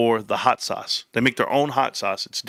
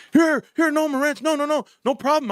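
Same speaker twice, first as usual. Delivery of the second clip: much higher, hurried apologetic owner voice; the boss falling over himself to fix it; flustered owner. Retold or imitated.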